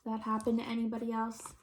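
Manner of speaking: plain reading voice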